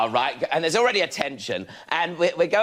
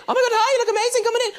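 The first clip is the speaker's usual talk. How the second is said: shrill escalating excitement; much faster than usual. Retold or imitated. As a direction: hit the top of her excitement. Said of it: "steadily escalating pitch of excitement".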